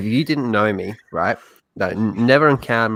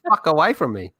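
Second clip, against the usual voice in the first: ordinary talk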